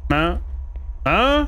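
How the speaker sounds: sharp high startled question; startled huh. Real, not acted. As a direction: jump at a sound. Sudden noise in game.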